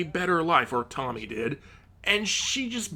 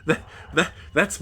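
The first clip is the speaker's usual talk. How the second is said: ordinary delivery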